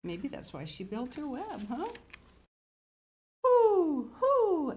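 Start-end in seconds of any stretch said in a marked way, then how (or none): 3.4-4.7 loud, high falling hoots; big drawn-out owl call. A narrator's reading. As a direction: hoot like a storybook owl. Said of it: "asked the owl".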